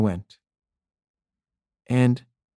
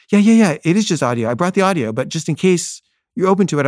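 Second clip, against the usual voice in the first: higher, louder, tumbling yeahs; hasty reassurance; 6 semitones higher, 3 dB louder than usual. Retold or imitated. Filmmaker hastily reassures John it is only audio, eager placating. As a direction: rush to head off an objection.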